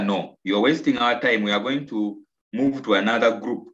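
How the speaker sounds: usual speaking voice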